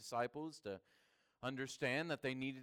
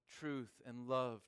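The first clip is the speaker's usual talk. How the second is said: ordinary talk, nothing marked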